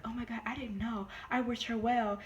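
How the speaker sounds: a hint of a high, fake-innocent voice; mock innocent victim. Mocking the doctor who played the victim, lightly high. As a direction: lightly mimic her playing dumb. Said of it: "you played the victim like".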